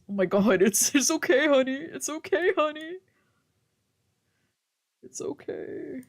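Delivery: high, gentle soothing voice; reassuring. Acted. Soothing the tearful Ray.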